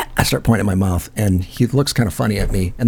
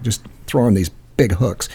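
ordinary talk

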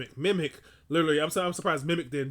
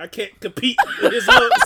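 louder, higher, laughing; laughing mock quote; 7 semitones higher, 12 dB louder than usual. The rumor giving up on Luther, laughing louder. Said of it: "The rumor's like".

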